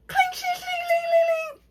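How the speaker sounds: high squeaky clink sounds; storyteller imitating shattering window with high clinks; glass clinking noise. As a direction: mimic breaking glass high and sharp.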